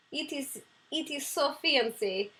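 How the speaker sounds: a bad Russian accent, only lightly put on. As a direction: play Mila, her silly Russian alter ego, with a lightly held Russian accent. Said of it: "[name], do your Russian impression".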